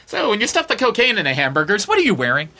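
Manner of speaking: put-on perky interviewer; mock interviewer. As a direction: pretend celebrity interview of a kingpin. Mock interview of drug lord.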